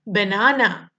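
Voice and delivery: ordinary delivery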